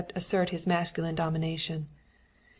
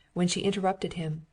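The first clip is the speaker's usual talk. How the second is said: ordinary delivery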